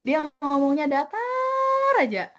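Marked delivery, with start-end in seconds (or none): 0.9-1.9 "datar" stretched on one held high note; drawn-out, unmoving "datar"; flat intonation. Shown as a demonstration. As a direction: hold "datar" long and level. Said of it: "nggak ada intonasinya".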